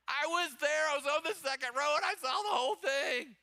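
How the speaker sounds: high, loud acted eyewitness gossip; excited boasting. Nail salon lady answering that she saw it, acted excitedly. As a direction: exclaim it like a thrilled eyewitness.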